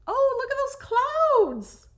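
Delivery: high, loud, slow burst of delight; delighted exclaim. Real, not acted. Crafter spotting lovely cloud drawings, delighted and loud. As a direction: let the clouds thrill you out loud.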